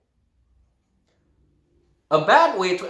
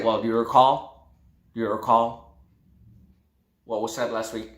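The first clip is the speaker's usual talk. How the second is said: low, flat question; low flat recall; 7 semitones lower than usual. Shown as a demonstration. Teacher to students, low flat recall question. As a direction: ask it low and dull. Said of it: "But if I say".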